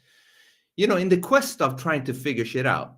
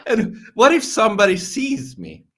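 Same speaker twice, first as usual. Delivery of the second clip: giggly and much higher; giggling; 8 semitones higher than usual. Real, not acted. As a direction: giggle through it. Giggly silly questions about heaven.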